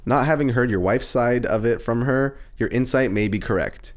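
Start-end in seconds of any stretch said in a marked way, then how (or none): none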